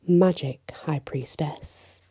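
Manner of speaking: ordinary delivery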